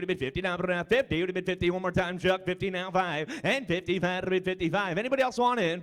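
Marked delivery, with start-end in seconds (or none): none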